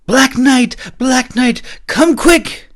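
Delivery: high loud frantic calls; boy calling desperately. A narrator's reading. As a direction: shout for help across the stable.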